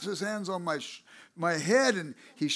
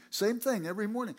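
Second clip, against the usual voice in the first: ordinary delivery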